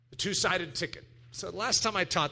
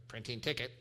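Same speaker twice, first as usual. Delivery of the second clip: machine voice; 7 semitones lower, 8 dB quieter than usual. Retold or imitated. Ticket machine again.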